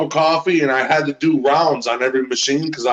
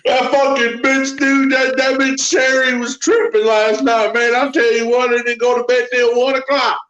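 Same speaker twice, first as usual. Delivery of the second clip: much higher, whiny worker voice; complaining Daryl; 8 semitones higher than usual. Acted. As Daryl griping about Sherry, much higher and whiny. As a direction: gripe like a henpecked worker.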